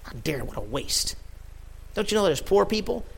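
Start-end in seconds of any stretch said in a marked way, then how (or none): 0.0-1.1 sulky Judas. Retold or imitated. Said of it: "he's like".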